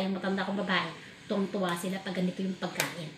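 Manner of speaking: usual speaking voice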